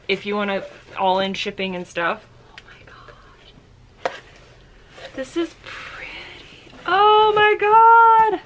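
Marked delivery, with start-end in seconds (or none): 2.5-4.1 breathless whispered gasp; awed whisper. Real, not acted. Gasp on seeing the coat. 5.1-6.1 soft, breathy whisper; admiring whisper. Real, not acted. Admiring the coat. 6.9-8.4 high, loud, drawn-out gush; delighted squeal. Real, not acted. Seeing the coat.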